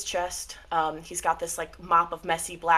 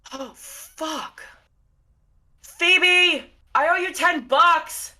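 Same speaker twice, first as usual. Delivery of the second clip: resigned shock turning to a yell; dismayed older woman; 5 semitones higher than usual. Acted. Atticus dismayed at seeing them arrive. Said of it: "she goes".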